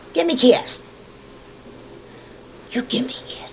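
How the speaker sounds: talking to a dog. Real, not acted. Coaxing pet for kisses.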